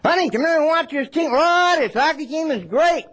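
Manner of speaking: mock sports-crazed gushing; gushing fan. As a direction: rave about the team. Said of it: "scream and rant and rave".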